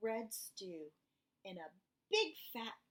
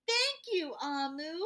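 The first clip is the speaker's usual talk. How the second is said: put-on little boy thanks; 6 semitones higher, 7 dB louder than usual. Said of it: "the little boy said".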